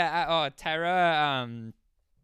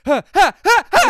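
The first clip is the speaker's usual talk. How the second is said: high, loud laughing screams in bursts; bursts of screaming; 14 dB louder than usual. Retold or imitated. Imitating brothers' screams heard from the next room, laughing bursts. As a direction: fire off short mock screams, cracking up. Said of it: "sounded like from the other room".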